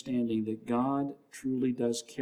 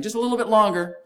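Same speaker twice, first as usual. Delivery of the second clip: high, loud gentle urging; a lifted, pleading encouragement; coaxing voice of God; 8 semitones higher, 7 dB louder than usual. Retold or imitated. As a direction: coax them through the last stretch. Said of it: "God's just saying".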